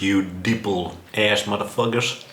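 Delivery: ordinary talk, nothing marked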